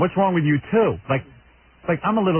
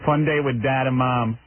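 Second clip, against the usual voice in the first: ordinary talk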